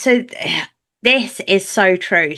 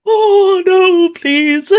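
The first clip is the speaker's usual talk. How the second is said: high, loud hammed-up wail; mock pleading; 11 semitones higher, 7 dB louder, much slower than usual. Empath sensing anger before the shouting, mock pleading. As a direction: wail it in mock dread, laughing at yourself.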